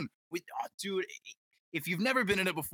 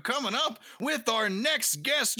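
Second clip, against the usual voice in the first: normal talking voice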